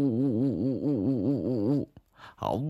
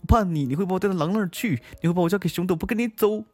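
put-on high, rapid girlish refusal; protesting Snow Maiden; 12 semitones higher, faster than usual. Snow Maiden refusing the sweet-talking fox, high fast protest. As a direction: scold in a high hurried little voice.

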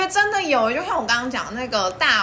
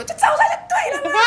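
mock impatient snap, much higher; impatient boyfriend; 3 dB louder than usual. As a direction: imitate him brushing you off. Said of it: "很不耐心". (模仿男朋友不耐烦)

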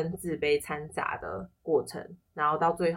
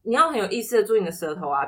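ordinary talk, nothing marked

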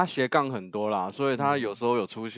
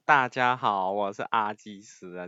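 usual speaking voice

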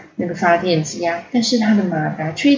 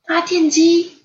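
high, louder puzzled query; puzzled question; 10 semitones higher, 5 dB louder than usual. A narrator's reading. Ponyo hearing of the generator, puzzled. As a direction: ask it as a baffled little child. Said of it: "看着波妞疑惑的样子".

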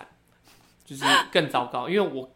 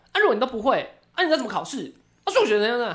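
angry lecturing; 7 semitones higher, 4 dB louder, faster than usual. Retold or imitated. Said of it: "然后我爸就很生气".

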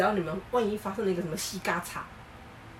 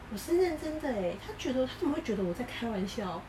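put-on soft girl voice; 4 semitones higher, 4 dB quieter than usual. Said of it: "她说".

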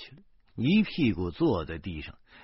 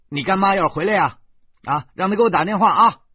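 raised, higher voice, brisk reply; loud instruction; 11 semitones higher, 10 dB louder, faster than usual. Acted. Man tells the girl to have godmother phone, loud and brisk. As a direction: call back quickly and loudly to the child. Man answers the child loudly.

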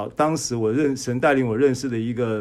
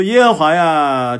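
a slow, loud cry to the Lord; drawn-out earnest invocation; fervent plea; 4 semitones higher, 9 dB louder, slower than usual. Acted. Quoting a psalm's plea to God. (缓慢而响亮的呼求)

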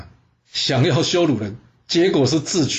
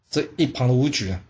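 ordinary delivery

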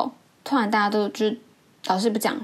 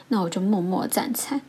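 ordinary delivery

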